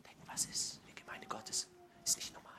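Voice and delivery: barely voiced, very quiet wondering; hushed inner thought. Children silently question their arguing parents, hushed inner thought. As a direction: whisper it like a private thought.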